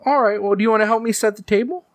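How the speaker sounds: fast, high cheerful mother; high mom voice. Acted. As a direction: coax it like a sweet mom.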